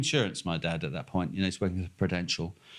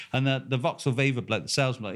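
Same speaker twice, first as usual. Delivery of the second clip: usual speaking voice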